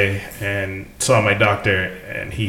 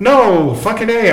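indignant; 11 semitones higher, 3 dB louder than usual. Real, not acted. Indignant reply to crude question.

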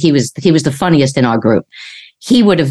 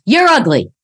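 high parrot voice; mock parrot jeer; parrot insult; 6 semitones higher than usual. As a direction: squawk it like a rude parrot.

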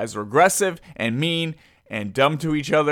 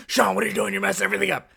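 higher-pitched imitation of scolding pals; mock your friends telling you off, pitched up; 6 semitones higher, much faster than usual. Friends making fun of Sean, mocking imitation. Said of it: "these guys made fun of me".

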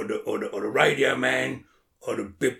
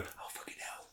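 soft whispered curse under the breath; imitating a shocked whisper; 23 dB quieter than usual. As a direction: hiss it so nobody hears. Retold reaction spotting a nonce.

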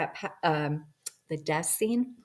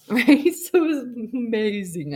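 laughing; 6 semitones higher, 6 dB louder than usual. Real, not acted. Amazed at own words.

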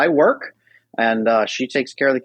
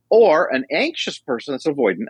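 ordinary talk

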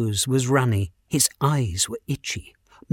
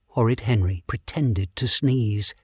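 ordinary talk

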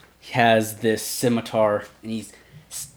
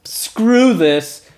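fed-up bugbear; 9 semitones higher, 5 dB louder than usual. Acted. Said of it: "he's like".

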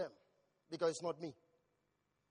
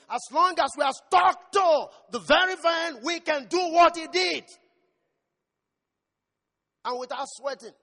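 much louder and higher, building to a shout; preaching at full force; 8 semitones higher, 12 dB louder than usual. Acted. Preacher rousing the church about resting in God, building shout. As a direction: thunder it at the church.

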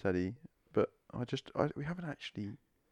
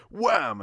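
loud higher mock wham; mock punch noise; 7 semitones higher, 10 dB louder than usual. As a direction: shout the punch sound.

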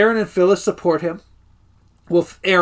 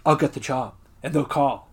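low, softer imitation of a self-assured speaker; mock pontificating; 4 semitones lower, 6 dB quieter than usual. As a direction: pontificate quietly, lightly imitating him. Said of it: "he's, like, pontificating".

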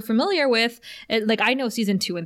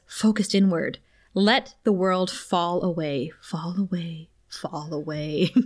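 soothing meditation voice; 3 semitones lower, slower than usual. Retold or imitated. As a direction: lull them gently, fading away. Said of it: "Giles's calming, sexy voice".

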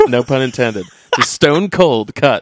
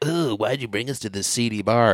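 softer, a lightly voiced snooty suit; prissy executives; 7 dB quieter than usual. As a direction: turn your nose up at the place. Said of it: "they're just like".

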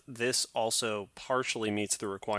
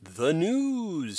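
dramatic announcer voice; 6 semitones higher than usual. Acted. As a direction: boom out the segment title. Announcing news segment.

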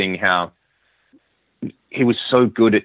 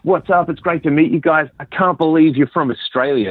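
much higher, bright, eager hello; enthusiastic greeting; 8 semitones higher than usual. Retold or imitated. Doug walking into the lobby greeting the Australian band, excited and higher. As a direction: greet new friends with delight.

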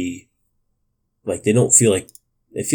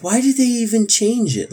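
exasperated; 13 semitones higher than usual. Retold or imitated. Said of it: "I was like".

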